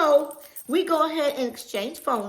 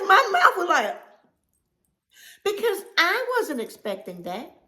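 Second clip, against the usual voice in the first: ordinary talk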